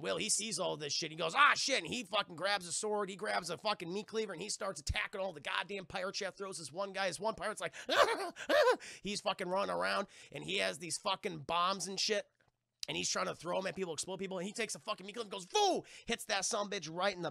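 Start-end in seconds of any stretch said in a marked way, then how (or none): 1.4-1.8 higher, loud alarmed curse. Retold or imitated. Will seeing the pirate invasion, a loud, alarmed curse. 7.8-9.0 very high, rising maniac cackle; pirate cackle. Retold or imitated. A pirate running around with bombs, a high, maniacal cackle. 15.4-15.8 high whooshing sound effect; swoosh noise. Retold or imitated. Will swinging the meat cleaver at a pirate, a high whoosh sound.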